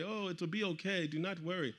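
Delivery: singsong mock reassurance; reassuring official. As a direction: mimic officials soothing away a complaint. Officials repeat their retold reassurance.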